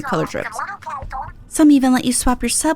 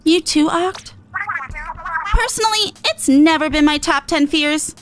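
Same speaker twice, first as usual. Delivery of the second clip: higher, brash little idol voice; cocky and upbeat, pitched up; Pearl voice; 9 semitones higher than usual. Acted. Streamer voicing Pearl to Eight, pitched up and cocky.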